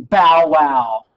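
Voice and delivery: a loud, lightly barked bow wow; mock dog bark. The dog barking at Jefferson, mock bark. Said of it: "The dog's going".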